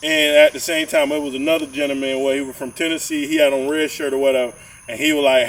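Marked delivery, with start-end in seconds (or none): none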